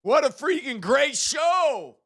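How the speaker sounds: high, slow triumphant yell; excited shout. Real, not acted. Host celebrating the stream's end, shouting triumphantly. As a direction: shout it out in victory.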